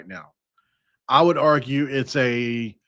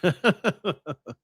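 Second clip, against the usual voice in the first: laugh; 6 semitones higher than usual. Real, not acted. Laughing at own impression.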